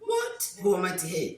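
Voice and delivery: high-pitched, loud mock-amazed outburst; amazed hype. Reacting to a friend's new six pack, high-pitched hyped amazement. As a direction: squeal it in playful disbelief.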